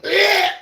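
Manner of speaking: loud, high "ugh"; disgusted groan. Retold or imitated. Reacting to a gross story while eating, mock disgust. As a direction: cry out in mock revulsion.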